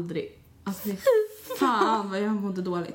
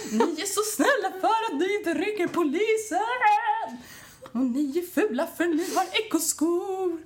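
put-on much higher, slow, laughing drunk gush; 9 semitones higher, slower than usual. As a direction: croon it out the window like a happy drunk. Yelling drunk at neighbours. (ljus, långsam, översvallande fyllröst)

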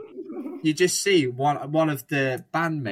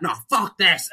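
higher, louder mock fury; mock angry outburst; 6 semitones higher, 6 dB louder than usual. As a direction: blurt it like a fed-up viewer snapping. Angry truck driver smashes telly.

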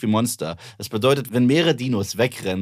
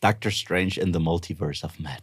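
lower, softer American accent title; american accent german; 6 semitones lower, 4 dB quieter than usual. Shown as a demonstration. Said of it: "du hast mit deinem amerikanischen Akzent, klingt's cooler".

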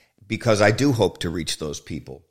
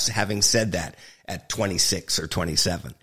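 usual speaking voice

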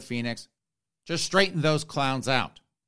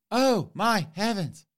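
shocked bishop; 7 semitones higher than usual. Retold or imitated. Bishop voiced in a sketch.

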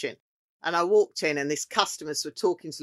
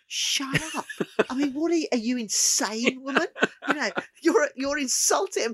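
a higher, exasperated mock scolding; imitating Marcus; 10 semitones higher than usual. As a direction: play the appalled boss telling her off. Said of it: "Marcus looking at me going".

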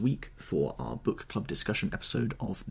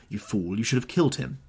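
louder, contemptuous rebuke; scornful scolding; 5 semitones higher, 5 dB louder than usual. Acted. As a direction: scold him as a fool. Woman scolding Vane.

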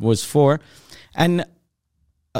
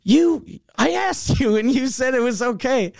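high-pitched, indignant defence; acted protest, voice pitched way up; defensive retort; 9 semitones higher than usual. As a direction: throw the blame back at the customer. Mechanic defends himself in quarrel.

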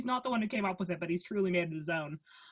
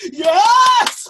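loud, high, delighted cheer; excited yes; 18 dB louder than usual. Retold or imitated. Coworker cheering Marlo's joke on, loud and high. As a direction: shout it with glee. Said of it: "and I'm like".

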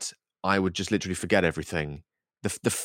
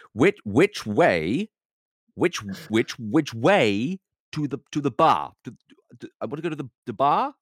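mock tourist, loud, slow and higher; louder and slower; 6 semitones higher, 3 dB louder than usual. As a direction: drag out each word, louder, as if to a foreigner. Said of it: "Louder and slower".

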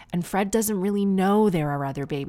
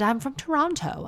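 much higher imitated posh twang; posh American, British twang; 9 semitones higher than usual. Mom says where she is from, posh transatlantic twang. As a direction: mimic a posh British-tinged North American accent. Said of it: "She sort of talked like this".

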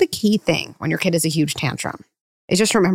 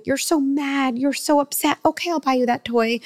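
mocking caving parent; 7 semitones higher than usual.

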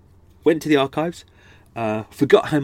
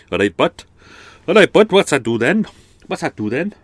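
put-on Cardiff Welsh accent, higher and louder; 6 semitones higher, 7 dB louder than usual. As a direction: throw out a cheeky Cardiff Welsh line. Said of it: "they needed an accent".

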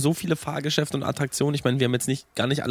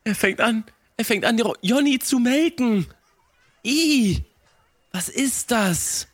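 shocked squeal; 10 semitones higher than usual. Pretend. Horrified at Jonny's milking.

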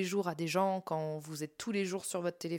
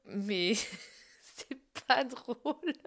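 breaking into laughter mid-sentence; slow, laughter swallowing the words; laughing; 6 semitones higher, much slower than usual. Real, not acted. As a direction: try to say it through real giggles. Laughs while saying it isn't funny.

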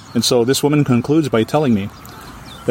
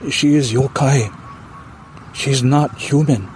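slow, grave warning; slow it down, let it chill; eerie warning; slower than usual. Retold or imitated. Wife warning her husband about the ghost, slow and grave. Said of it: "And the woman says".

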